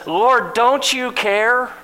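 high, slow, indignant plea; Martha's frustrated outburst; exasperated snap. Acted. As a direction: throw the question at him, fed up. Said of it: "Martha snaps. She's ticked".

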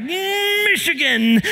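strained, high growl into the word; mock cursing. Pastor acting out cursing in his mind, high mock fury. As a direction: spit out a place name like a swear word.

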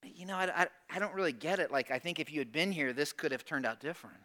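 soft, hesitant, low-key complaint; subdued, acted-out puzzlement; Mary's different tone. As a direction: say it gently, stumbling into the hurt. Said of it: "A little different tone".

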